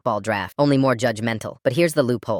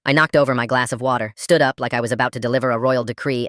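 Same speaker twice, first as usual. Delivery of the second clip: normal talking voice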